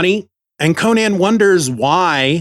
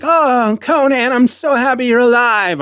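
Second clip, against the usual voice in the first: much higher, gushing queen voice; swooning queen; 9 semitones higher than usual. Retold or imitated. Podcaster mocking the queen greeting Conan, gushing. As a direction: gush over him like a smitten queen. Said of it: "she's like".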